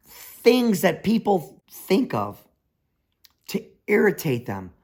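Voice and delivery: usual speaking voice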